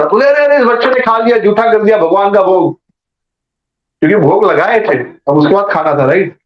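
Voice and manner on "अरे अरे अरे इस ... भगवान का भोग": high-pitched, acted alarm; mock dismay. Brahmin seeing the child eat the offering, dismayed. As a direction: cry out in retold dismay, pitched well up. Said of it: "तो एक अजीब सा emotion था".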